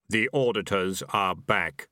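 slow, low reaper voice; unhurried Death, every word weighed; grim reaper voice. A narrator's reading. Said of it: "said Death".

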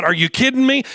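loud, high disbelief; incredulous. Real, not acted. Pastor to congregation, loud incredulous cry. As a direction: throw it out in disbelief.

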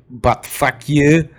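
loud, drawn-out mock Russian; Russian accent. Shown as a demonstration. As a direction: demonstrate a slow Russian-accented curse. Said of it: "In Russian, you say".

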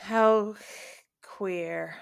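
very soft, low echo; quiet dry aside. Pretend. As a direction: drop it to a low, soft murmur. Dry echo of co-host's mock quote.

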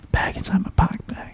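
whispered musing to himself; puzzled whisper. Retold or imitated. Son puzzling over mom's question, baffled whisper. Retold puzzled inner thought.

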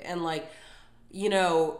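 ordinary talk

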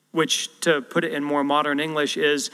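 usual speaking voice

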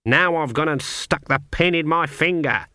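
usual speaking voice

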